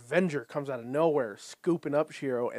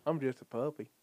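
puppy voice; 3 dB quieter than usual. Retold or imitated. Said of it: "Tom's fucking puppy voice".

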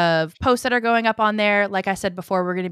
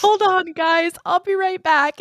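high, louder breezy excuse; mock casual excuse; 11 semitones higher, 5 dB louder than usual. Playing her friend hiding tears on stream, high bright excuse. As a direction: cover it up brightly and cheerfully. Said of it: "turn your chair".

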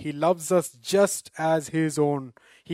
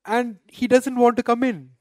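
higher and louder, stressing want; emphatic indignant preaching; 8 semitones higher, 7 dB louder than usual. Real, not acted. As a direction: cry out that he refused to come in. Repeats the elder son's refusal.

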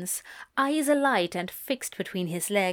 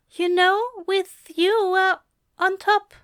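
Aziraphale voice; 10 semitones higher, 5 dB louder than usual. A narrator's reading. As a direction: voice him shy and higher.

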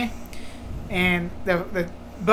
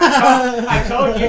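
laughing, louder told-you; 3 semitones higher, 8 dB louder than usual. Retold or imitated. Cleared boy to principal, laughing louder gloat. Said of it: "And I was like".

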